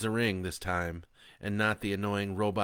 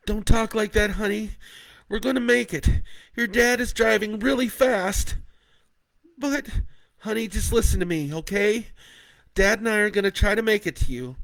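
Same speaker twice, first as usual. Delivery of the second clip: reassuring; 13 semitones higher, 10 dB louder than usual. Acted. Leah reassuring Kat.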